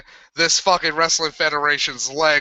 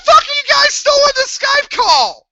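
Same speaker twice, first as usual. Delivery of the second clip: screaming high and loud at friends; real angry high yell; furious shout; 7 dB louder than usual. Real, not acted. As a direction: yell them out of the call. Yelling at friends in call.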